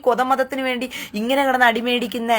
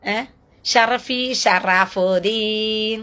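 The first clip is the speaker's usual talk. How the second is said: drawn-out taunt; much slower than usual. Acted. Taunting the target by name.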